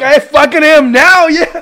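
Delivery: high, loud, drawn-out comeback; retold defiant shout. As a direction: shout it back triumphantly, slow and high. Acted comeback in a retold quarrel.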